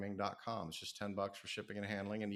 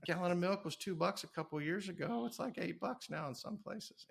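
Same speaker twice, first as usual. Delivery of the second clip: much higher, half-mock exasperated lecturing; exasperated retort; 10 semitones higher than usual. Retelling his reply to a critic, mock exasperated and high. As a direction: lay it out like it's obvious, pitched way up.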